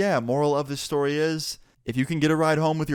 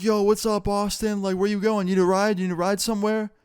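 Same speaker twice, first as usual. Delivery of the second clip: high-pitched imitation of friends calling out; upbeat friendly greeting; 8 semitones higher than usual. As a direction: call out like a buddy pulling up, pitched well up. Friends pull up, greet him cheerily.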